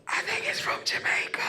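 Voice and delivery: hushed, secretive whisper. Retold or imitated. Strangers guessing her origin, whispered gossip. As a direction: whisper it behind someone's back. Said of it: "I'll hear a whisper".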